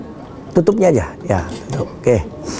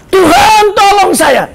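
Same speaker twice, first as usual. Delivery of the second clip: hammed-up loud, high call for help; crying out; 6 semitones higher, 4 dB louder, slower than usual. Drowning believer calls God while a log floats beside, crying out. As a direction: holler to heaven for rescue. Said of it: "kalau dia teriak".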